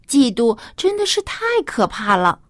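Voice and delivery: ordinary delivery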